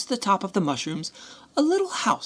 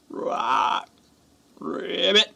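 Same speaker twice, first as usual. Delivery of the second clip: frog croak; much slower than usual. Acted.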